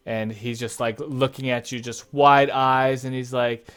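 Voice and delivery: ordinary talk, nothing marked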